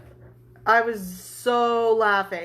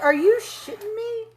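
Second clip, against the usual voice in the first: higher, astonished question; 9 semitones higher than usual. Retold or imitated. Wife asking her husband if he's joking, astonished. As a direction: react as if he can't be serious.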